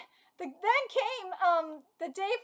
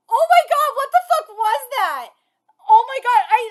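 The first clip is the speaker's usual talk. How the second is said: ordinary talk, nothing marked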